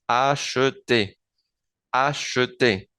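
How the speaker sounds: acheter said slowly as ah-sheh-tay, the first e pronounced; acheter, slow ah-sheh-tay with a light first e; acheter with first e voiced. Shown as a demonstration. Teacher slowly models acheter with the first e sounded. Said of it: "la primera E no suena como E, sino como E".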